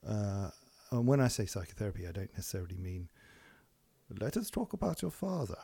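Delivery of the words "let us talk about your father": mock German psychoanalyst, pitched high; a Freud-style German accent. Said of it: "an excuse for me to do my German accent".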